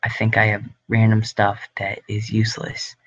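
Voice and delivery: normal talking voice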